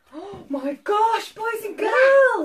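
loud, drawn-out high exclamation; mock alarm. Reader warning boys and girls of danger, mock alarm. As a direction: gasp it out to the whole room. Reader reacts to scary roar.

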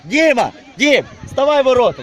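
loud shout across the pitch; yelling from the sidelines. Real, not acted. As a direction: shout the order so he hears. Coach calling player to goal.

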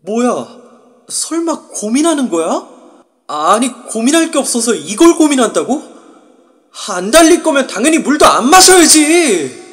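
rising, loud disbelief; indignant outburst. Acted. Narrator indignant inner outburst.

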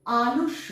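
আলস্য said as "alossho", with a doubled sh; loud, careful আলস্য with the sh held; clear pronunciation demo. Shown as a demonstration. Teacher demoing the word to pupils, careful and clear. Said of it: "দন্ত স জফলা".

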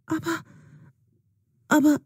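halting, confused stammer; confused girl stammering at her friend. Acted. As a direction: stumble over the word, lost for words. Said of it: "stammelt Juna".

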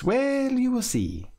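high, teasing evasion; mysterious, high-pitched reply; coy Armand. Acted. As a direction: dodge the question playfully.

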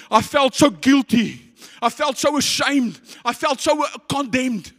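high, forceful pulpit voice; high-pitched, fervent testimony; impassioned preaching. Real, not acted. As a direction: preach it with rising urgency.

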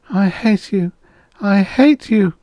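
high-pitched anguished moan. A narrator's reading. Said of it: "she moaned".

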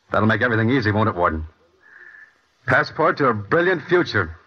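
bitter sarcasm. Acted. Bitter jab at his parole papers.

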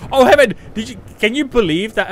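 high, loud delighted call; excited greeting. Real, not acted. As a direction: greet a friend with a burst of excitement.

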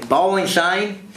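high, drawn-out Aussie cricket call; Aussie wicketkeeper's rising cheer; Australian accent. Shown as a demonstration. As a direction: call it out slowly in broad Australian. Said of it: "whenever I'd have to just get into it".

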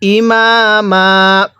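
ইমামা ending in a long drawn a, without the final n; pausal ending. Shown as a demonstration. Teacher showing how to pause on the word. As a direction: stop on ইমামা and stretch the last vowel. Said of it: "তাহলে কিভাবে পড়ব?".

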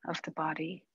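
calm, unhurried meditation guide with long pauses; guided meditation. Acted. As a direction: ease them gently into stillness, softening as it goes.

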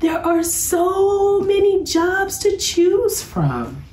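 put-on high, slow, overwhelmed little girl; a cub sighing there are too many choices; Sister cub voice. A narrator's reading. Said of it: "said Sister".

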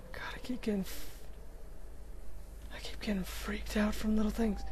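hushed, jumpy whisper; frightened whisper. Real, not acted. Jumpy gamer admitting fear, whispering. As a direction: confess it under your breath.

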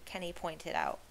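ordinary delivery, nothing marked

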